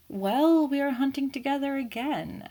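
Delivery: bursting out high and loud; excited. A narrator's reading. Hastings eagerly reminds Poirot, loud and high. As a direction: blurt it with a grin.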